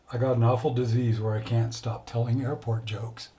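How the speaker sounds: ordinary talk, nothing marked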